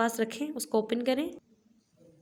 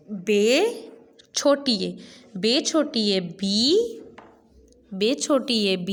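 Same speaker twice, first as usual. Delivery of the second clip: slow, spelled out, rising on बी; slow phonics drill; slower than usual. Shown as a demonstration. Teacher drills बे छोटी ए बी slowly for pupils. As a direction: drill each sound slowly. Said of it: "ये देखें".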